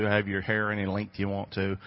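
ordinary talk

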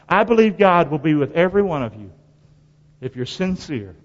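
ordinary delivery

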